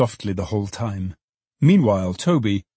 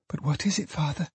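put-on soft, high girlish query; daughter's teasing question; 6 semitones higher, 7 dB quieter than usual. A narrator's reading. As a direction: ask gently, pitched up. Daughter teasing father to guess.

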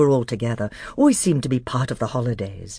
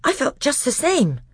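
breathless; 12 semitones higher than usual. Acted. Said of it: "said Edmund in a breathless voice".

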